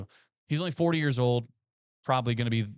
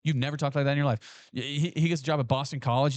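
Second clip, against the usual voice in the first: ordinary talk, nothing marked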